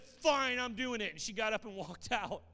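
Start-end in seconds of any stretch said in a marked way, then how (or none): 0.0-1.1 very high and loud; a woman storming out, defiant and loud; defiant retort. Retold or imitated. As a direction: fire it back in defiance.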